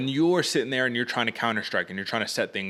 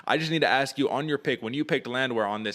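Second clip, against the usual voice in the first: ordinary talk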